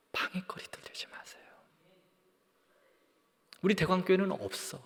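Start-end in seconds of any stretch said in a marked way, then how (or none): 0.1-1.4 very soft, voiceless appeal; whispered plea. Real, not acted. Preacher urging believers not to be hindrances, whispering. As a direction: sink to a whisper to urge them.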